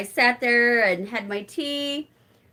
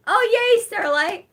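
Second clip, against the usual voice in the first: much higher, louder cheer; excited; 12 semitones higher, 6 dB louder than usual. Real, not acted. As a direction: cheer the name happily. Reacts to viewer comment.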